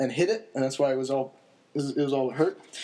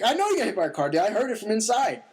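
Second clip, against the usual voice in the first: retold retort; 8 semitones higher, faster than usual. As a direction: shout it back like it's obvious. Friend answers from the porch.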